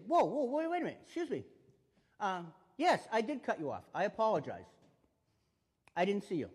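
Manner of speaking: high, loud, placating apology to a driver; calm apology. Shown as a demonstration. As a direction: talk an angry driver down. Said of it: "So we say".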